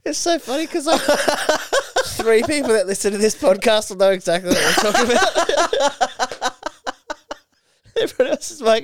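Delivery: laughing. Real, not acted. Laughing between impressions.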